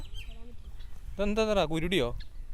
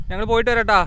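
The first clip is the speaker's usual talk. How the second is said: raised, carrying voice; calling out; 3 semitones higher, 8 dB louder than usual. Real, not acted. Telling friends they are going and will return, calling out. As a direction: call it loudly to someone at a distance.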